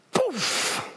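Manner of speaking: high, airy "poof"; vocal puff of sudden impact; imitated blast. Imitates force knocking people over.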